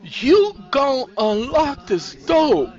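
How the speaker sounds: slow, loud, high order; forceful demand. A narrator's reading. Sandra ordering Nisi to unlock up, forceful. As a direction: stretch each word into a command.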